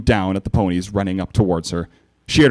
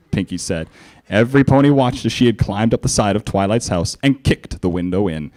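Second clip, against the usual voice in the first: plain reading voice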